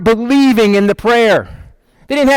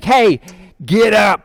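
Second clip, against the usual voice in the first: wake-up call; slower than usual. Acted. Angel wakes Peter.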